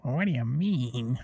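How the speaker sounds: slow, drawn-out singer impression. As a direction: stretch it out in a mock rock-singer voice. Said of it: "thinking about [name]".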